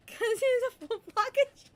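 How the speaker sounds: high, loud playful outburst; excited joking retort. Real, not acted. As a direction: blurt the joke out gleefully.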